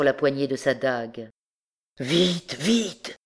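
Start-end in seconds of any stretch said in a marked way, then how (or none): 2.0-3.2 urgent. A narrator's reading. Hunchback drags the horse onward, urgent. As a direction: hurry him along.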